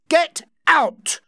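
high, loud furious shout. Acted. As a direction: bellow him out of the house. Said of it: "Rawdon shouted".

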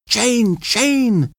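high, frantic calling of a name; frightened scream. A narrator's reading. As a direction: scream her name in fear. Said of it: "screamed Kathy".

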